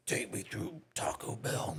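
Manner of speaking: put-on mumbled, slow drunk demand; low, slow drunken mumble; drunk buddy. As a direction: slur it like a drunk friend. Drunk buddy quoted at drive-thru.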